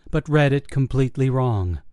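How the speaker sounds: ordinary delivery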